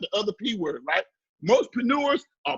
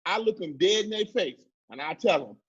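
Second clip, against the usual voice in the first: ordinary talk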